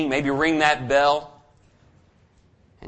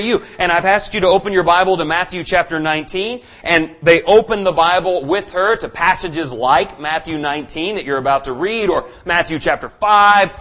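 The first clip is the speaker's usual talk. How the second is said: higher and louder, driving sermon voice; preaching at full force; 5 semitones higher, 5 dB louder than usual. Acted. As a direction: preach it hard to the congregation. Preaching the verses, raised voice.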